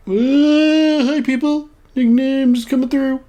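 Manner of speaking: high-pitched, playful passing announcement; high call-out. Pretend. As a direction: call out high, drawing it out as you pass. Calls out while passing through in game.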